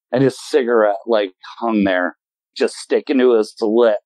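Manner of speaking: normal talking voice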